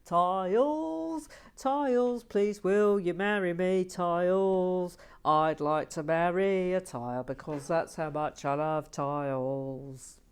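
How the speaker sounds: loud lovesick tile proposal; mock tile lover. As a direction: swoon over tiles like a lover. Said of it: "[name]".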